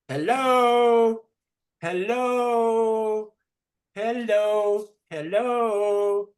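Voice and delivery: Hello? very high and bright. Shown as a demonstration. Teacher urging children, hello very high. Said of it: "Say it, children".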